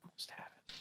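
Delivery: breathy whisper to herself. Real, not acted. Recalling a character name, whispered to herself. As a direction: whisper it, straining to remember.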